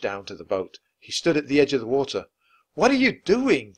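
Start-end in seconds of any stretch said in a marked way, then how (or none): 2.7-3.7 high, loud alarmed shout. A narrator's reading. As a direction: yell at his sister in the boat. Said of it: "Jack shouted".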